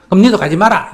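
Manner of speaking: loud scolding shot back at the son; strong retort. Shown as a demonstration. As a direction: hit back hard at the son. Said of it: "이래서 딱 세게 나와야 된다".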